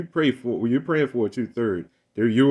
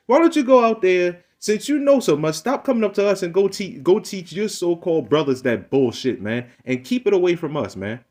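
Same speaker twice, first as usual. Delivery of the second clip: raised and higher, angry lecturing; heated rant; 6 semitones higher than usual. Real, not acted. Ranting at the man who keeps questioning him, heated. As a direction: let him have it, voice climbing.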